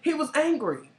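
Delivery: voiced emphasis. Real, not acted. Repeating to cohost that he was angry, high and emphatic. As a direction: say it out high, no whisper. Repeats it aloud after whisper.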